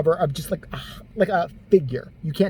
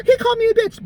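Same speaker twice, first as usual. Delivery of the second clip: much higher, louder, hammed-up outrage; mock offended woman; 6 dB louder than usual. An offended woman mocked for being called a bitch, hammed-up and high. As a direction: squeal it like a scandalized victim.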